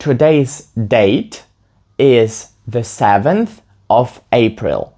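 very slow, clear model sentence; deliberate, word-by-word classroom read; slow teaching example. Shown as a demonstration. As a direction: spell it out slowly for learners to copy. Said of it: "Наприклад, сьогоднішня дата".

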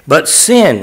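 "sin" pushed up in pitch, then falling; stress on sin. Shown as a demonstration. Preacher pointing out the verse's emphasis, stress on "sin". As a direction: punch the word "sin". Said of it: "this emphasis on the sin".

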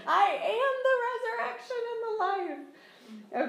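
high laughing delivery; joyful laughing proclamation; laughing quote. Retold or imitated. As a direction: laugh the words out with delight. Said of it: "quoting Jesus again".

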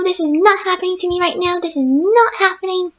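ordinary talk

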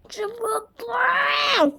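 high gushing cry on tatlı; squealed. Real, not acted. Gushing to viewers about the cute fairy cat, high squeal. Repeats, second take squealed.

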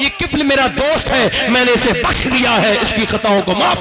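high, loud impassioned sermon voice; full-force preaching. Acted. (پرجوش بلند آواز میں خطاب)

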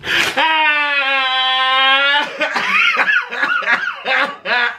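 high drawn-out wail breaking into sobbing bursts; long wailing outburst. Pretend. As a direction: wail at length, then break up. Loud outburst after salad remark.

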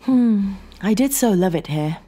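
high, wistful lament; sadness. A narrator's reading. Sarah looking round the cabin, mildly sad. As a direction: sigh over a place once loved. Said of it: "The sadness in her voice".

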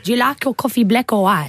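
light South African accent. Shown as a demonstration. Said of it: "I could do a good South African accent".

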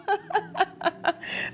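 a high, airy giggle from the puppet. Acted. Puppet giggles at the crow's fire plan, high and airy. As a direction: giggle as the puppet, tickled by the idea.

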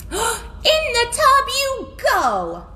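high, slow storybook command; bossy washerwoman. A narrator's reading. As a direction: order them firmly in a high voice. Scolding washerwoman orders animals to bath.

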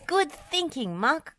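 high-pitched praise; pleased cry. A narrator's reading. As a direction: exclaim it gladly. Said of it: "cried Wendy".

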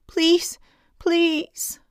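high, drawn-out begging; desperate cry. A narrator's reading. Boy begging bullies not to lock him in, desperate. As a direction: plead helplessly, voice pitched right up. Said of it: "he cried".

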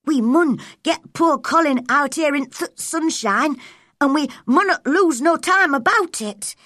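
put-on high, lively Yorkshire accent; Yorkshire dialect. A narrator's reading. Girl shows her friend her new dialect, Yorkshire accent. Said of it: "Mary loved Dickon's Yorkshire dialect".

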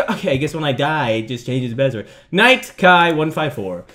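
ordinary talk, nothing marked

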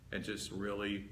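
usual speaking voice

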